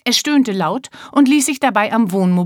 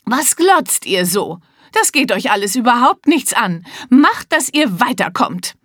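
rude snapping; 5 semitones higher, 3 dB louder than usual. Acted. Mister Hilarious snapping at Violet and Zack. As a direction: bark at the staring kids. Snaps at staring children.

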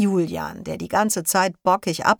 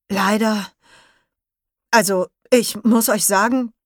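higher, halting, hesitant announcement; shaken bad news; 5 semitones higher, 4 dB louder than usual. Leo's father tells the class Yuma is gone, halting and shaken. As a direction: force out terrible news in pieces.

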